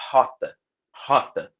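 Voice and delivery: "rota" with a breathy h-like R, like a puff of air; aspirated R in rota. Shown as a demonstration. Teacher to students, the Brazilian aspirated R in "rota". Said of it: "Que a gente fala".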